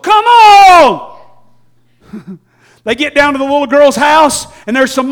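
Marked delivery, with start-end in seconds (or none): 0.0-1.2 high, loud shout of impatience; impatient shout. Retold or imitated. Father urging Jesus to hurry, loud impatient shout. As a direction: hurry him along. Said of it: "The father's like".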